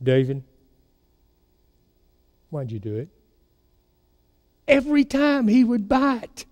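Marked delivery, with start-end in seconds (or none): 0.0-3.1 low and much softer, almost hushed; mother's soft probing question. Retold or imitated. Mother at dinner softly fishing for son's confession, hushed. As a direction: lure out the confession quietly. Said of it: "Or she would say".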